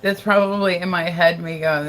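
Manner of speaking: ordinary talk, nothing marked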